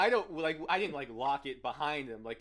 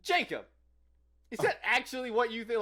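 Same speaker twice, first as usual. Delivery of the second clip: louder and higher, calling across the store; retold loud challenge; exasperated call-out; 9 semitones higher, 6 dB louder than usual. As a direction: call out to a coworker in disbelief. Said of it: "I was like".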